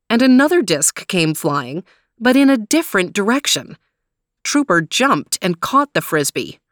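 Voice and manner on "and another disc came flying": usual speaking voice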